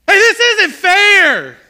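put-on very high, loud, drawn-out child's wail; whiny little girl. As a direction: yell it like an entitled little girl. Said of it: "in this little girl whiny voice".

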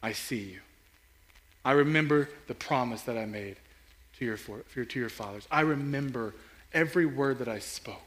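soft, low, reassuring; tender voice of God. Retold or imitated. God promising his people he remembers, soft and tender. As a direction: promise it quietly, like a father. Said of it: "it ends with God saying".

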